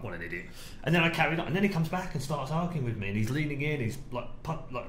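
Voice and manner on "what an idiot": muttered aside. Retold or imitated. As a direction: lower and softer, under the breath. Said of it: "one of those, like".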